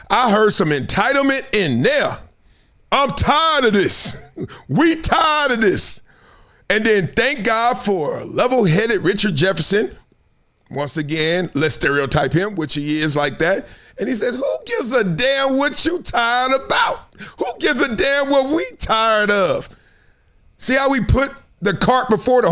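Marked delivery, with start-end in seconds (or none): 2.9-6.0 high, drawn-out mock grievance; hammed-up "so tired" whine; mock weary complaint. Mocks Perkins's entitled complaint. 14.3-19.7 higher, drawn-out rhetorical challenge; imitated incredulous comeback; mock exasperated retort. Jefferson's retort, acted out.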